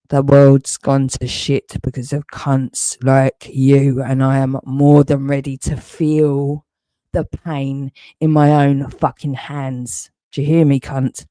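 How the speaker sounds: usual speaking voice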